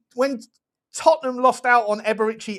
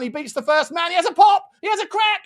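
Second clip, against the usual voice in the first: rising, shouted play-by-play; excited commentary; 10 semitones higher, 3 dB louder than usual. Real, not acted. Streamer calling a Spurs chance, excited shouted commentary. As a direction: call the shot as it flies.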